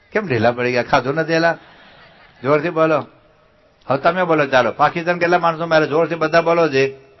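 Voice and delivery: jolly them up for being limp; loud teasing. Real, not acted. Speaker ribbing listless children to shout back, loud and teasing.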